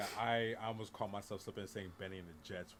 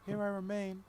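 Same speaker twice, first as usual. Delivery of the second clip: higher put-on character voice; mock movie character; 10 semitones higher, 10 dB louder than usual. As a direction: ham up the film line as a joke. Quoting Dune character line.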